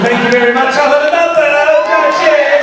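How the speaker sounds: high, loud upper-class cheers; posh English toast. Acted. Actor ending his bit with a loud posh toast. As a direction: raise a glass like a toff.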